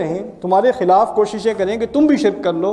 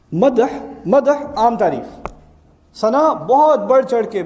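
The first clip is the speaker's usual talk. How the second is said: slow teaching emphasis; 3 semitones higher, slower than usual. Shown as a demonstration. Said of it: "teacher contrasting two words".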